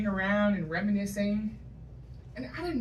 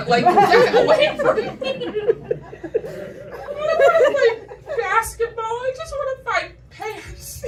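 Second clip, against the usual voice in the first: much higher and louder, fed-up rant; exasperated outburst; 13 semitones higher, 8 dB louder than usual. Pretend. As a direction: burst out in mock exasperation. Exasperated outburst about height.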